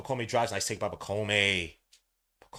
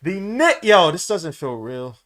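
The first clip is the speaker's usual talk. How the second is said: overwhelmed yelling; 5 semitones higher, 10 dB louder, slower than usual. Real, not acted. Overwhelmed right after the win.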